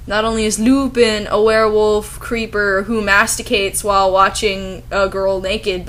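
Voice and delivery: ordinary delivery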